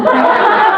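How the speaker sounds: short high burst of laughter. Real, not acted. Marina laughing at her own shock, high.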